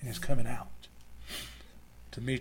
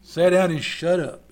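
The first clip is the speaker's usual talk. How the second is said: loud, raised, forceful order; blunt rebuke; 4 semitones higher, 10 dB louder than usual. Acted. Preacher repeating Jesus' rebuke in modern words, loud stern order. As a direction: command it with booming authority. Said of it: "That's basically what he said".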